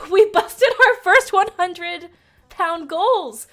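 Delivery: loud, high, laughing through it; bursting with glee; laughing announcement. Real, not acted. Streamer telling chat the goals are smashed, laughing loudly.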